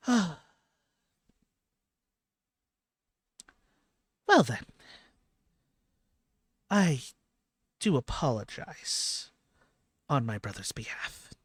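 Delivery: starts high and loud, then settles; relieved, apologetic goddess. Acted. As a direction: apologize formally on a brother's behalf, in measured phrases. Game master plays relieved goddess.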